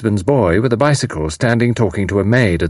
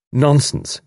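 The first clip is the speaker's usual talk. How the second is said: higher, brisk dismissal; robust; 7 semitones higher than usual. A narrator's reading. Tuppence reassures frightened mother, robustly. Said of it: "said Tuppence robustly".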